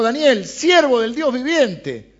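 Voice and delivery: king calls out slowly, high and loud; calling out loud. Acted. King calls Daniel in the lions' den, loud slow cry. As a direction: shout his name down into the pit, slowly. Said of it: "llamó a voces a Daniel".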